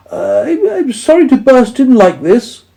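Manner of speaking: high, sheepish voice; apologetic mole. A narrator's reading. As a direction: stammer out a sorry as a shy mole. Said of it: "said the mole".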